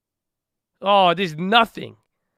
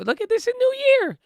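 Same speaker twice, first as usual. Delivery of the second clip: much higher, squealing with delight; excited squeal; faster than usual. Real, not acted. Vlogger showing viewers his New Year gains, delighted squeal. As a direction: squeal it out like you can't believe it.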